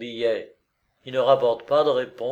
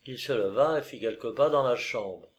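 ordinary delivery

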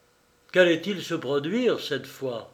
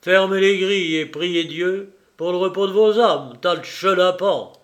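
mocking; 3 semitones higher, 7 dB louder than usual. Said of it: "approuva Lupin calmement".

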